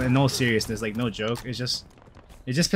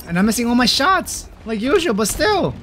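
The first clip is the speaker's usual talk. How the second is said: high, raised complaint about the team; exasperated outcry; 13 semitones higher than usual. Real, not acted. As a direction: cry out in frustrated disbelief. Exasperated as teammates lose.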